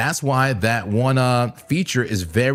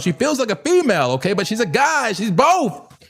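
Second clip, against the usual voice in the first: excited; 8 semitones higher than usual. Real, not acted. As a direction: hype it up playfully. Joking about Bitcoin as she.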